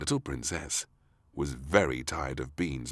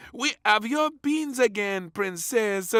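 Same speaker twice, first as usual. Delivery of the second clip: high, loud cheerful chef; 6 dB louder than usual. A narrator's reading. Chef tells princess the beans are back. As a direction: announce it like a proud cook.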